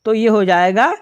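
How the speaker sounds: usual speaking voice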